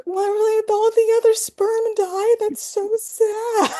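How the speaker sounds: put-on high, slow little girl heartbroken over sperm; child's sad voice. Mother imitating her small daughter, high and sad. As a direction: pitch way up like a tearful small daughter. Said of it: "she started crying".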